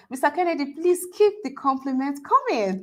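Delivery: ordinary talk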